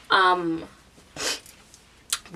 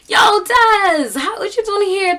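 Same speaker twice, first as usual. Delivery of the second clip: loud, high-pitched mock holler; hailing a friend; 11 semitones higher, 5 dB louder, slower than usual. Friend pulling up on his skateboard, calling out. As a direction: call out an excited greeting, imitating him.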